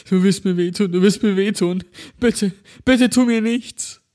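high, slowed, tearful begging; holding back sobs. Acted. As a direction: plead through sobs. Double sobbing, pleading.

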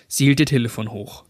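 ordinary delivery

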